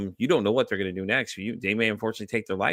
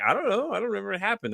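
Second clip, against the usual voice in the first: high-pitched, helpless protest; mock cluelessness; 10 semitones higher, faster than usual. Hungover man answering his friends, mock clueless. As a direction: go high as if baffled by the question.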